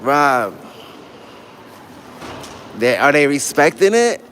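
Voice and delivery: ordinary talk, nothing marked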